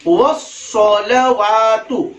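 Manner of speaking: "वस्सलवातु" slow, as "was-sa-la-waa-tu"; slow, careful "वस्सलवातु", long waa; correct recitation. Shown as a demonstration. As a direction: draw out "वस्सलवातु" with a full, rounded s and every vowel. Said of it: "सही तलफ्फुज क्या है?".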